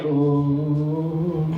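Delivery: low, soft droning hum; Roomba-like tourist. Retold or imitated. Foreign tourist wandering into the shop, Roomba drone. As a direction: drone vacantly like a robot vacuum. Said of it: "that weird, like, Roomba energy".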